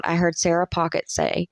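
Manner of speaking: ordinary delivery, nothing marked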